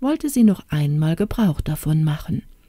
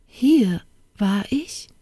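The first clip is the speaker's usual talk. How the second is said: slow, higher, tremulous question; trembling voice; 4 semitones higher, slower than usual. A narrator's reading. Princess learning she was here, trembling voice. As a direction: ask haltingly, with a touch of trembling. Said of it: "fragte sie mit bebender Stimme".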